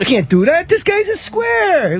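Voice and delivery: movie character impression. Quoting Casino to co-hosts, high slow mock voice. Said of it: "John Barrymore".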